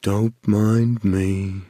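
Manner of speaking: low, very slow, gloomy donkey drawl; Eeyore voice. Acted. Guest finally voicing Eeyore for the hosts, slow and low. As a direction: drag it out like a glum donkey. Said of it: "just lower your voice".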